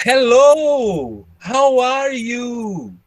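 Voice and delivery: high, long swooping Brazilian intonation. Retold or imitated. Teacher mocking their old English, exaggerated Brazilian intonation. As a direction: exaggerate Brazilian English, stretching each final vowel slowly. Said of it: "This is Brazilian intonation".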